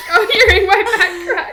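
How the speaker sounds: high, loud laughing; laughing reading. Real, not acted. Reading her old journal aloud to her friend, laughing loudly. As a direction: read it out cracking up.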